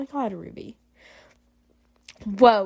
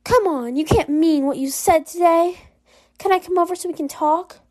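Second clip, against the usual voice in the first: higher-pitched, pleading caller; Ruby; 6 semitones higher than usual. Acted. Caller asking to come over and talk, higher-pitched and pleading. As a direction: coax them in a raised pitch. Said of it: "Ruby, I'm busy".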